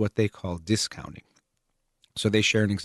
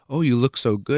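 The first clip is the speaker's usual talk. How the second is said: higher, louder, gushy compliment; gushing compliment; 6 semitones higher, 7 dB louder than usual. Acted. Someone complimenting a friend's looks, gushing. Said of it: "if someone says".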